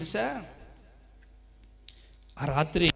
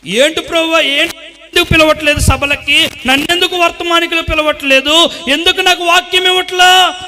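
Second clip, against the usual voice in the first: higher, louder aggrieved pleading; complaining to God; 11 semitones higher, 14 dB louder than usual. Retold or imitated. Preacher acting out his complaint to God, aggrieved pleading. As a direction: plead with God, feeling overlooked.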